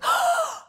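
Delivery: high, loud gasp of awe; starstruck gasp. Retold or imitated. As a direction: gasp as if you've spotted a huge star. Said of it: "I'm never like the person that one goes".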